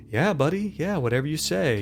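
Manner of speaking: high-pitched, humoring coaxing; placating. Acted. Orderly talking down to a restrained patient, placating. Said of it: "like, placating him".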